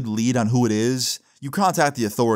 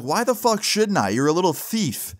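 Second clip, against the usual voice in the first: angry; 6 semitones higher than usual. Retold or imitated. Angry confrontation on phone.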